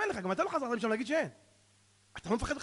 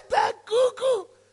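high, loud, stretched-out mock hilarity; bursting with laughter; 11 semitones higher, 8 dB louder, much slower than usual. Mourner telling the rabbi he's crazy, bursting with laughter. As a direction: squeal it slowly as if cracking up. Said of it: "הוא כמעט התפוצץ מצחוק".

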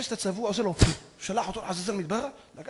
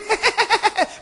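short high loud cackle; hyena laughter; 13 semitones higher, 7 dB louder than usual. Acted. Preacher mimics hyenas to the audience, cackling.

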